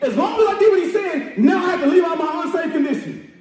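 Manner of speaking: shouted, rousing sermon cadence; preaching at full force. Real, not acted. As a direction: preach it hard to the congregation.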